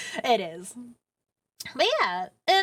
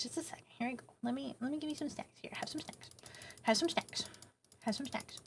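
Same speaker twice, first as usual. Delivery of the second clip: very soft, hushed coaxing; playful whisper; 3 semitones higher, 13 dB quieter than usual. Pretend. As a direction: whisper it as if slipping someone treats. Coaxes viewers to take snacks.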